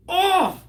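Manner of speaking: high, sudden exclaimed "oh!"; crowing "oh!". Real, not acted. Teller reacts to the brutal fat joke, high "oh!". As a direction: let out a high cry at a savage joke.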